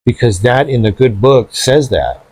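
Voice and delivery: usual speaking voice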